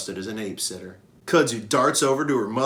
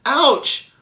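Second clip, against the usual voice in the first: sudden high yelp of pain, louder; a sharp little ouch; 9 dB louder than usual. Acted. Young elephant Ruby yelping when bitten on the tail. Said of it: "she yelps".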